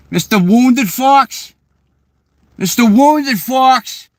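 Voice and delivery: put-on high, loud, drawn-out New England accent; New Hampshire accent. Imitating locals mispronouncing a last name, loud South Shore accent. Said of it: "they'll pronounce my last name so weird".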